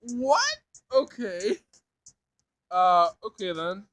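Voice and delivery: going along, bewildered; flustered surprise. Acted. Sam answering the robot's offer, baffled and loud.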